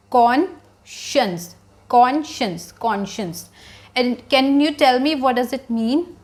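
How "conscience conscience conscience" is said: "Conscience" broken into KON, shuns, then whole; correct pronunciation. Shown as a demonstration. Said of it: "we pronounce this word as". ("Conscience" का सही उच्चारण, कॉन... शंस)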